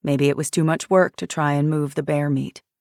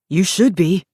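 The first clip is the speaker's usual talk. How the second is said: louder blurted warning; sudden louder outburst; burst out; 2 semitones higher, 6 dB louder than usual. A narrator's reading. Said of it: "The words burst out of him".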